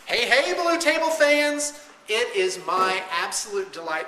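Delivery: high, loud hyped host greeting; booming kids show opener. Acted. Restarting hyped show intro.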